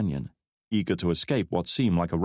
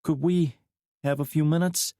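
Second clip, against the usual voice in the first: higher woman's voice, asking lightly; woman's request; 8 semitones higher than usual. A narrator's reading. McKnight asking to be alone with Drake, lightly. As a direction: ask the first time, no push. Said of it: "McKnight repeated, putting emphasis on every word".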